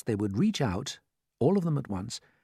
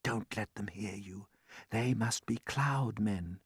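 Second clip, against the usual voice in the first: low, hushed warning; soft, secretive undertone; whispering; 3 semitones lower, 8 dB quieter, slower than usual. A narrator's reading. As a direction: keep it down so they can't hear. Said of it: "James whispered back".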